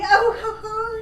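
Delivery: high-pitched imitation of a giggly woman; mocking, high tittering; mock giggle. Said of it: "She's giggling".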